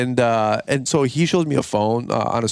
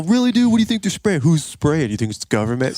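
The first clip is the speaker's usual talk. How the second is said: mocking disbelief; 4 semitones higher than usual. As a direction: needle him like he's crazy. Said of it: "he's just smacking me with".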